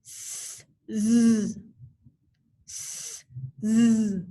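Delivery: S hissed with no voice, z buzzed with voice; sound demo. Shown as a demonstration. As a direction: hiss the S, buzz the z. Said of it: "Say it with me".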